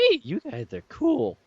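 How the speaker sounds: high, slow goofy voice; squeaky put-on voice; high silly voice. Putting on a silly voice.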